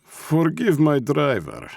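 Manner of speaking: put-on high, slow Russian-accented apology; heavy Russian accent. A narrator's reading. Russian boss excusing his driver to the old man, heavy Russian accent. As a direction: draw it out with a thick Russian accent. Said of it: "said the shortest of the men in a heavy Russian accent".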